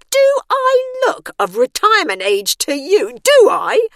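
indignant outburst. A narrator's reading. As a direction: Bernice bristles at the insult. Bernice indignant at retirement remark.

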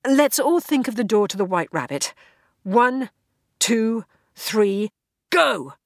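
rally them into the count; urgent countdown. Acted. Character urging a countdown.

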